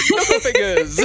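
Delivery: loud, high, laughing call of the name; laughing exclaim. Real, not acted. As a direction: blurt it out through a laugh. Showing a funny picture, laughing.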